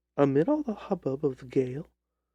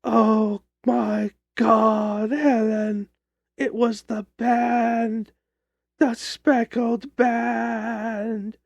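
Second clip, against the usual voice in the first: higher, louder, drawn-out cry of terror; a dying woman shrieking a warning, slowing on the last words; terrified shriek; 5 semitones higher, 4 dB louder, much slower than usual. A narrator's reading. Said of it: "shrieked out in a voice which I shall never forget".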